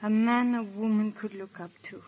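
normal talking voice

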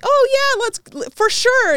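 high, loud enthusiastic agreement; mock excited star; excited high yes. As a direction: gush it eagerly. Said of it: "And he's like".

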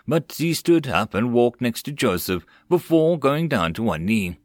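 usual speaking voice

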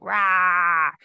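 growled dinosaur roar; mock roar of a T-Rex. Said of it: "like, a ferocious T-Rex".

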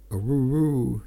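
lightly voiced crowd roar Aroo-roo; a mild mock cheer as the roof falls; crowd yell. Crowd yelling as the barn roof collapses, mild cheer. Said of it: "yelled the crowd".

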